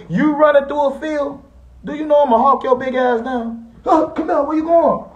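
high mocking chase call, laughing through it; mock chase taunt. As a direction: taunt him like you're chasing him down a field. Taunting a heavy rival, chasing him.

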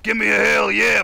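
a shouted hammed-up hell yeah; mock Stone Cold shout. Streamer reading a donation as Stone Cold, shouting. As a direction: bark it like a wrestler cutting a promo. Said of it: "I can't do a Stone Cold".

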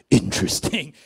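low, loud, mean-sounding verdict; imitated intimidating rock star. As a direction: size him up in a deep voice. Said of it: "used to be a lot meaner sounding".

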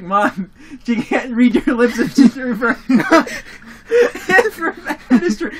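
laughing through the words; cracking up mid-sentence; giggling while scolding mom. Retold or imitated.

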